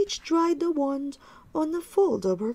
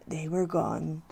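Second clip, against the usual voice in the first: usual speaking voice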